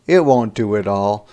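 plain reading voice

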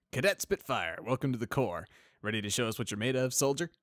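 a hearty sergeant's greeting; friendly sergeant. Acted. Sergeant voiced.

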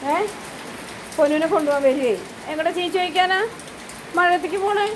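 high sing-song pet talk. Real, not acted. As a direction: coax the dog gently in a high voice. Comforting sad dog in rain.

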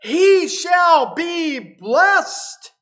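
rising into a crying shout; trembling. Acted. Isaac realising he blessed Jacob, breaking into tearful shout. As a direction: let it break into a loud tearful cry on the last line. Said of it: "Isaac trembled violently".

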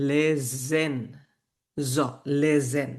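লেজেন said with a voiced z in the middle. Shown as a demonstration. German teacher showing the s said like z to a student. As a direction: stress the z in লেজেন. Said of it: "এসটাকে জেড এর মতো করে উচ্চারণ করতে হয়".

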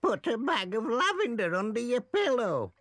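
Granny Dryden. A narrator's reading. Granny Dryden advising the child, high granny voice. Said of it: "Granny Dryden said".

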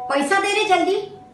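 a pushy street voice clapping for cash; demanding hijra. Acted. (उंच आवाजात पैसे मागणारा किन्नर)

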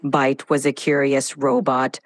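robotic voice. Shown as a demonstration. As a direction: speak like a robot narrator. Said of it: "Initiating dramatic robotic voice".

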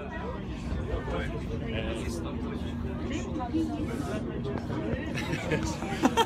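a burst of high laughter; real laugh. Real, not acted. Vlogger laughs at the song, high and bright.

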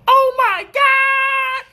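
high, very slow mock shriek of a woman; drawn-out shriek. A sister watching police rush in, slow high mock shriek. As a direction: stretch a shrill oh my God way out. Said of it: "sister go".

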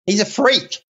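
high, mocking imitation of a baffled wife with a laugh; imitating wife. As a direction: play the wife scoffing at her strange husband. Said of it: "my wife's looking at me like".

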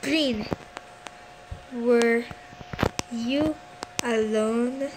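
long pauses after "Green", "were" and "you"; word by word. Real, not acted. Player questioning Green, "Green, were you alone?" broken by long pauses.

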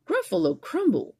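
high, loud aghast echo; horrified monster. Acted. Gruffalo echoing the mouse, high mock horror. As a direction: repeat it in horror. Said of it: "the Gruffalo said".

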